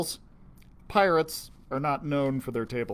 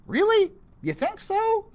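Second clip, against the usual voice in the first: surprised; 6 dB louder than usual. Acted. Pete surprised, hopeful.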